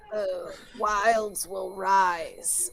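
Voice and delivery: low, very slow ominous line; ominous NPC. Acted. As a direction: drag it out, low and foreboding. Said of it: "cringes a little bit and is like".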